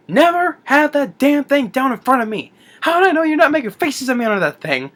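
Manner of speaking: high, ranting mock outrage; mock angry scolding. Retold boss scolding Rick Moranis's character, mock outraged. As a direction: tear into him, hammed up.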